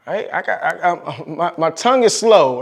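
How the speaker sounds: ordinary delivery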